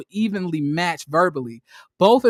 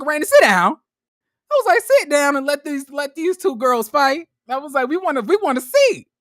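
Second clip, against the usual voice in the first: higher, louder playful heckle; mock heckling; 7 semitones higher, 5 dB louder than usual. Host heckling Arena from the couch, mock shout. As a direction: egg on the fight from the couch.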